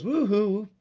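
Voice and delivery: high falsetto whoop in a put-on voice. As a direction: belt a high celebratory woohoo like the pop star. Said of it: "that crazy dance and goes".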